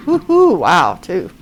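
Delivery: mock celebration; mock cheer. Real, not acted. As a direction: cheer big, half joking. Sarcastic cheer at two viewers.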